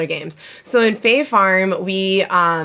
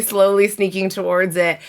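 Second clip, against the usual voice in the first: ordinary delivery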